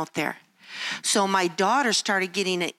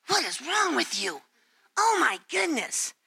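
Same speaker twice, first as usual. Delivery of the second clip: higher, scornful inner critic; self-critical scolding; 5 semitones higher than usual. Pretend. Speaker voicing her harsh inner critic, scornful. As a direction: scold yourself in disbelief. Criticizing ourselves after encouraging friends.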